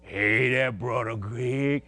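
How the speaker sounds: preacher imitating his big college friend's deep hello; Big Mike's deep voice. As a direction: drop way down and stretch it out like a huge freshman. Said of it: "he had a big old deep voice".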